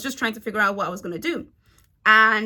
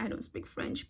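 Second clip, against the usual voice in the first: breathless whisper to a crush; 1 whispered reply; 13 dB quieter than usual. Retold or imitated. Starstruck tourist answering the handsome Frenchman, whispered.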